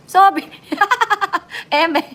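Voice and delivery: high, loud burst of laughter; laughing out loud. Real, not acted. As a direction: crack up at her own joke. Laughing at her own joke. (malakas na halakhak)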